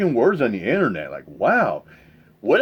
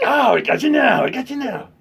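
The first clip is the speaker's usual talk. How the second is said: high, taunting troll voice; mock trolls; 9 semitones higher than usual. Imitating trolls gloating at a triggered streamer, taunting. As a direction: gloat like a troll who won. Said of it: "They're like".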